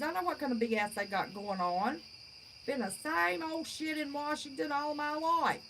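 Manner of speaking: usual speaking voice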